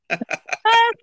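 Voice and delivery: sudden high burst of laughter. Real, not acted. Laughing at his own cat joke, a high burst. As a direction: laugh out loud at the punchline.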